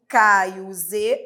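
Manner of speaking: ordinary talk, nothing marked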